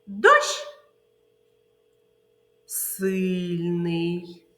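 "сильний" drawn out slowly after a quick "Дощ"; quick noun, slow adjective; slow sign word. Shown as a demonstration. Teacher modelling the rain word game. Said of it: "слова, ознаки повільно".

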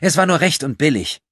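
high, loud defensive voice; self-justifying. A narrator's reading. Thief justifying herself to two men, defensive. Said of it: "verteidigte sich Corinna".

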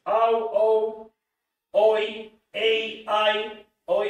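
loud, high exaggerated diphthongs; diphthong drill. Shown as a demonstration. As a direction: belt out each diphthong like a drill. (diptongos exagerados y fuertes)

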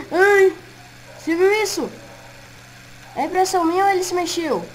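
alarmed, voice jumping up; frightened. Acted. Animatrônico se mexeu. (assustado, voz aguda)

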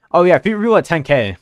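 fast, loud hyped promise; excited. Real, not acted. Streamer hyping chat with a loud fast promise. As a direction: rattle it off to chat, pumped.

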